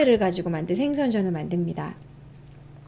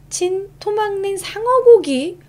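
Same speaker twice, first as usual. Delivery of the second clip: 상어고기 read higher, louder, slower in amazement; surprised read; 9 semitones higher, 5 dB louder, slower than usual. Real, not acted. Reading surprising fact. (놀라서 높게 읽기)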